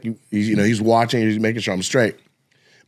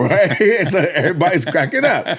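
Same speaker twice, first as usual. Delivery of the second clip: ordinary talk, nothing marked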